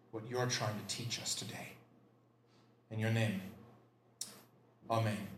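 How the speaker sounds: hushed, low opening prayer. Real, not acted. Pastor opening the sermon in prayer, hushed and low. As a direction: pray softly and reverently.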